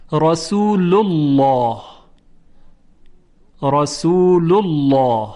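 slow "رسول الله", heavy dark l in "الله"; full, rounded lam in "رسول الله"; thick lam. Shown as a demonstration. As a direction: recite "رسول الله" as a drawn-out "rasoolullawh". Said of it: "لام الله تفخیم بشه".

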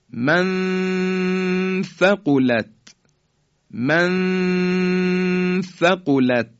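"من ثقلت" with a long high hum on the noon; ikhfa. Shown as a demonstration. Teacher recites example for repetition, slow ikhfa. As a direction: prolong the hidden noon of "من ثقلت" into the tha. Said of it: "این طرز اخفای".

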